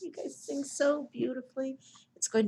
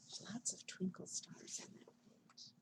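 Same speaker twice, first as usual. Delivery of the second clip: very soft unvoiced aside; whisper; 4 semitones lower, 17 dB quieter than usual. Real, not acted. Librarian pointing out stars, whispering. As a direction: whisper so as not to wake anyone. Aside while reading to kids.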